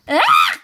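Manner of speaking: very high, loud shriek of disgust; farmer's shriek. Acted. Farmer suddenly seeing a goblin, shrieking high and loud. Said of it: "shrieked the farmer".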